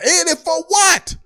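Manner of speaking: shocked at the price; outraged disbelief. Retold or imitated. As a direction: blurt it out in disbelief.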